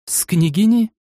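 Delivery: высокое испуганное восклицание; вскрикивает в испуге; с ужасом. A narrator's reading. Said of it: "воскликнула с каким-то ужасом". (с ужасом, высоко)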